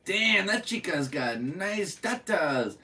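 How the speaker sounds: put-on radio Spanglish accent. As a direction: keep the cheesy radio Spanglish voice going. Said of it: "And they're like".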